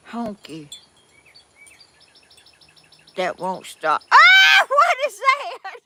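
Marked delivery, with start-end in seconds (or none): 4.1-5.8 very high shriek of surprise; startled shout. Real, not acted. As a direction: yelp at a sudden noise. Startled by honky noise.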